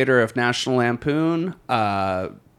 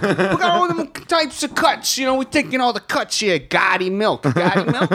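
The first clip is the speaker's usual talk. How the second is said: mobster pitchman hawking milk; high-pitched mock gangster salesman; mafia ad voice; 7 semitones higher than usual.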